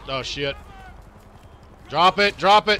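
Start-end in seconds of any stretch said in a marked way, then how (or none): 1.9-2.8 commanding shout. Acted. Cop ordering suspect.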